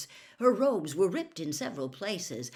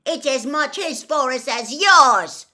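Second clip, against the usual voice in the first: loud, higher angry yell; 9 semitones higher, 14 dB louder than usual. A narrator's reading. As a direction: shout back in defiance. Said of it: "he yelled".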